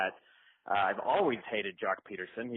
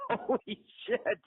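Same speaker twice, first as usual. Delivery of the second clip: high, slow, laughing holy shit; laughing disbelief; 12 semitones higher, slower than usual. Retold or imitated. Fans stunned by a second home run, laughing. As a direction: laugh through your amazement.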